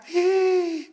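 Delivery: high, loud mock shriek; long piercing comic scream; mother-in-law's scream. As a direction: shriek back in shock, hammed up. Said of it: "Y ella grita".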